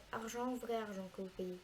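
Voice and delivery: ordinary delivery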